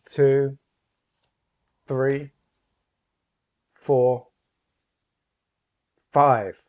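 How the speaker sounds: loud, measured stage count; booming slow countdown; hypnotist's count. Acted. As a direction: count it out grandly.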